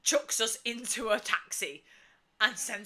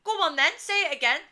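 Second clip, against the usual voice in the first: higher, louder quick retort; fast, goading mock shout; shouting back; 7 semitones higher, 7 dB louder, faster than usual. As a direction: imitate a friend shouting back at hecklers. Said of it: "shouts back at them".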